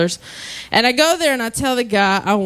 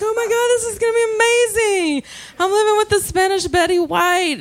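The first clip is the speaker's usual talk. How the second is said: comedian retelling delight, high and gushing; acting out giddy excitement in a high voice; thrilled gush; 8 semitones higher than usual. Comedian recalls meeting the sweet old landlady, high excited gushing.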